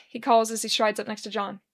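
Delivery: ordinary delivery, nothing marked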